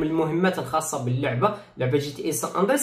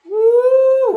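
high, loud amazed oh; 9 dB louder, much slower than usual. Real, not acted. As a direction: cry out in wonder. Reacts to the motorbike. (دهشة عالية)

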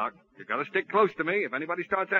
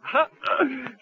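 nervous laugh; 5 semitones higher than usual. Acted. Stunned man covering with laugh.

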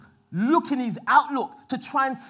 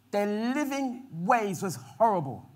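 ordinary talk, nothing marked

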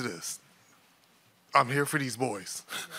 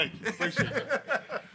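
thanks broken up by real laughter; laughing thanks; 11 semitones higher, 6 dB quieter than usual. Real, not acted. New head coach thanking the athletic director, laughing. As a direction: laugh through the words, pitch jumping up on appreciate.

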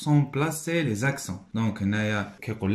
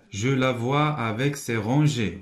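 slow reading; slower than usual. Shown as a demonstration. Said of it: "Il y en a naître".

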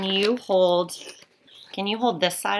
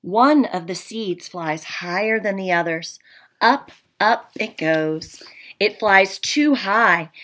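storybook read-aloud, a little louder, with hushed asides; reading to children; 5 dB louder than usual. Acted. Teacher reading The Tiny Seed to her class. Reads picture book to class.

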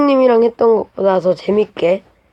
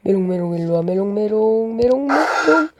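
taunting. Acted. Taunting in game.

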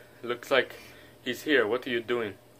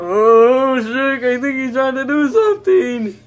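high, loud alarmed cry; alarmed warning; 10 semitones higher, 8 dB louder, slower than usual. Pretend. Donkey warns Shrek the enemy is fighting back, high and alarmed. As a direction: warn him in a loud, high panic.

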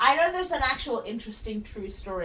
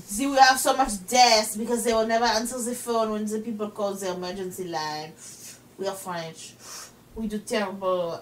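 hammed-up French official; terrible French accent; 3 semitones lower than usual. Mock French official explains the ennui crisis, terrible French accent. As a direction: lament it in a bad French accent. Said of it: "doing terrible French accents".

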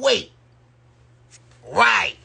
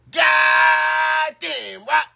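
excited shout; 7 semitones higher, much slower than usual. Real, not acted. Hyped exclamation on stream.

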